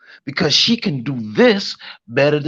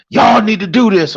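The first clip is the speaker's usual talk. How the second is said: mock bossy order; 7 semitones higher, 4 dB louder than usual. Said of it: "I never go".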